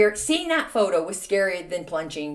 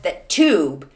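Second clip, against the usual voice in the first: usual speaking voice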